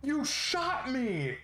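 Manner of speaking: high, slow, stretched-out yelp; pained shriek of betrayal; wounded guard's outraged cry. Acted. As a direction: wail it like you've just been shot.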